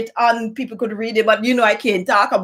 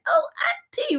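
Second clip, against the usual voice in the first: much higher and softer, an imitated eager child; excited kid voice; 6 dB quieter than usual. Imitating the niece calling her aunt, high excited child voice. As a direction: squeak it out like the niece running in.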